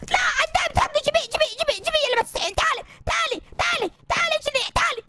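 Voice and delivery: high-pitched frantic demand; alarmed calling. Pretend. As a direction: call the thief back in a high, urgent voice. Chasing jinn who took diamond.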